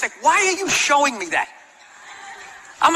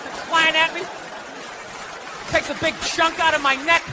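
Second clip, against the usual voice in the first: usual speaking voice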